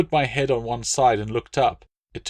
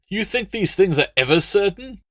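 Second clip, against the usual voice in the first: dismissive legate; 11 semitones higher, 5 dB louder than usual. Acted. Latius dismissive.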